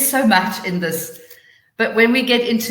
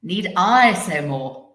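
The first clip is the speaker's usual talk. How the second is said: "I" drawn out, louder and stressed; 5 dB louder, slower than usual. Shown as a demonstration. Teacher showing how stress changes meaning, stress on "I". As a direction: slowly punch the word "I". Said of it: "underlining different words".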